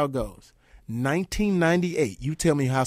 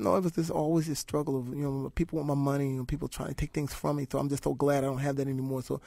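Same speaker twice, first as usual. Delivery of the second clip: soft imitation; 8 dB quieter than usual. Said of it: "He said".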